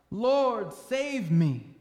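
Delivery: crying out. A narrator's reading. Sinking disciple cries to Jesus for rescue, crying out loudly. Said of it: "cried out".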